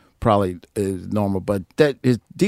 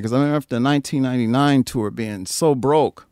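ordinary talk

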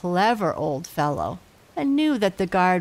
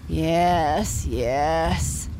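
sleepy papa; 2 semitones lower than usual. A narrator's reading. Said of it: "said his papa sleepily".

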